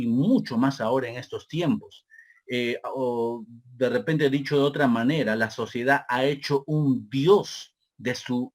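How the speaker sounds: normal talking voice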